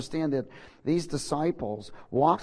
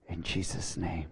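prayer; 5 semitones lower than usual. Acted. Pastor leading the congregation in prayer, hushed and fading. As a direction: pray them into the throne room, fading down.

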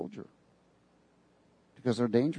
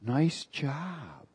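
soft, sweet, coaxing praise; a devil's gentle flattery, softer; gentle tempting voice; 3 semitones lower, 8 dB quieter, slower than usual. Retold or imitated. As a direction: purr the compliments like a tempter. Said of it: "the first words out of his mouth was real gentle".

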